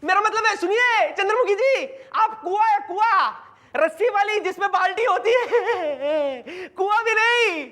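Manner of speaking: high, overexcited flattery; gushing praise. Acted. Boss praising woman who agreed to help, hammed-up gushing.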